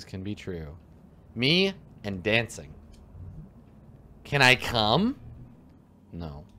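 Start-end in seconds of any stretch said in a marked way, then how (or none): none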